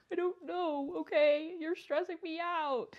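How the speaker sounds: high, whiny fake sob; mock-crying outburst. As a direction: wail it as an overwhelmed student about to cry. Retold tearful outburst at the teacher.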